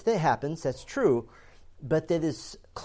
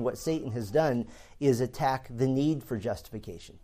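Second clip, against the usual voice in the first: usual speaking voice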